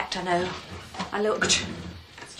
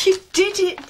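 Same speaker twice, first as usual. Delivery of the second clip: high and loud with joy; excited; 9 semitones higher, 9 dB louder than usual. Real, not acted. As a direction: cheer him on. Mother cheering son's pass.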